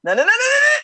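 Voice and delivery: playful protest. Real, not acted.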